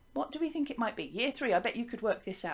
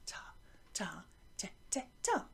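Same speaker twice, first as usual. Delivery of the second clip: whispering the rhythm under the class; barely voiced chant beside the children; whispered along; 3 semitones lower, 11 dB quieter than usual. Shown as a demonstration. Teacher whispering along while pupils chant. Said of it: "One more time".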